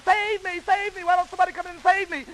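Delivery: high-pitched, hammed-up cry for rescue; yelling for help. A narrator's reading. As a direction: play a storybook damsel yelling for help. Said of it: "Red is yelling".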